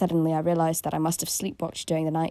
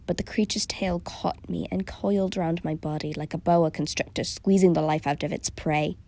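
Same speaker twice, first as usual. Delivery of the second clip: ordinary delivery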